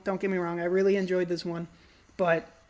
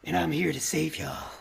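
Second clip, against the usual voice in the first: low Batman tough-guy act; 5 semitones lower than usual. Retold or imitated. Film fan mimicking an actor trying to be a badass, Batman voice. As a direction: lower it into a mock superhero badass. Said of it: "tries to put on the Batman voice".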